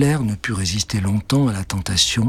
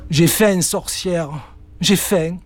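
whining cat; 7 semitones higher, slower than usual. Acted. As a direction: moan for more food. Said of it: "ses jérémiades". (jérémiades aiguës)

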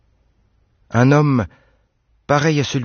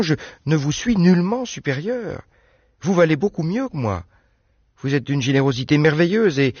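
put-on higher, eager young woman's voice; Anne protesting; 8 semitones higher than usual. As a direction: insist he has it all wrong.

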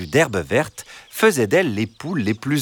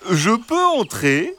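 high, slow, gentle fox voice; soft reassuring fox; 12 semitones higher, slower than usual. A narrator's reading. Fox sweet-talking the hens at the gate, high and gentle. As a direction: coax them sweetly in a high sly voice. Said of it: "Une voix douce et rassurante perça le silence".

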